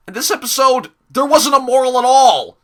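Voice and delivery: loud, high-pitched outrage; worked-up loud complaint; exasperated rant. Real, not acted. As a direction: raise the voice in indignant disbelief. Ranting about the episode.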